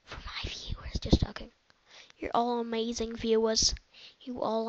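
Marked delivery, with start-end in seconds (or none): 0.0-1.0 whisper. Acted. Whispered aside after outburst.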